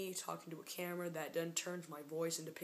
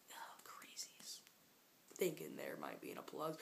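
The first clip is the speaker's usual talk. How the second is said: soft whispered mock scorn; hushed aside under the breath; 7 dB quieter than usual. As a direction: whisper it to yourself. Muttered aside about crazies.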